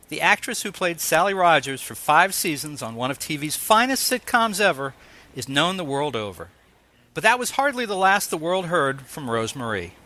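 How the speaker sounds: usual speaking voice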